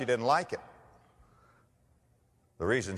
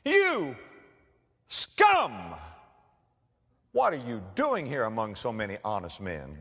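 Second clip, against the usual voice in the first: mock scolding king; slower than usual. King mock-scolds honest prisoner.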